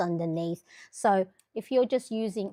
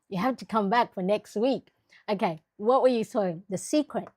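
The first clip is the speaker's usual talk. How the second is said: normal talking voice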